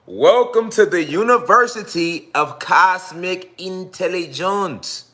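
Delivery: a grand slow host intro; slow enunciated announcement. Shown as a demonstration. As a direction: stretch every syllable of "Intelligence" out slowly. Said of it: "We gotta enunciate it different".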